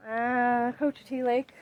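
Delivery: ordinary talk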